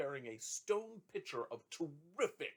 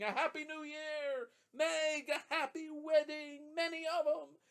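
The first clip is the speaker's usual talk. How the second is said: screaming neighbors; 13 semitones higher, 5 dB louder, slower than usual. A narrator's reading.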